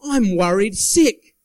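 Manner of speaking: worried sick drawn out slowly and loud; slow emphatic phrase. Shown as a demonstration. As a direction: stretch out worried sick for effect. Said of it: "heard the term".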